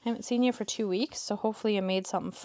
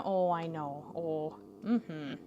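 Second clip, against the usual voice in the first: ordinary talk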